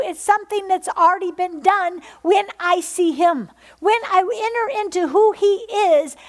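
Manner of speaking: high, loud full-force sermon; fiery preaching. Acted. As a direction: preach it out to the congregation.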